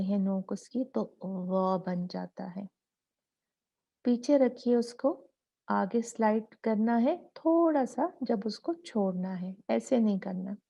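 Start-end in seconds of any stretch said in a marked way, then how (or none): none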